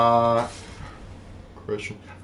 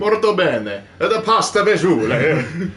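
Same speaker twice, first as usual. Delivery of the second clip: hammed-up Italian accent, high and loud; 5 semitones higher, 4 dB louder, slower than usual. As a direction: play up a mock Italian, laughing at the end. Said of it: "could you do an Italian accent".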